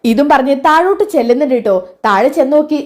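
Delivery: plain reading voice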